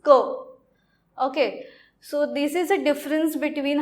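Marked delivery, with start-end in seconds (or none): 0.0-0.6 "ko" starting with a k; careful teacher's "ko" with hard k; hard k sound. Shown as a demonstration. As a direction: pronounce "ko" with k, no s sound. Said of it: "it should be pronounced ko".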